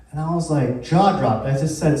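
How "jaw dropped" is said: usual speaking voice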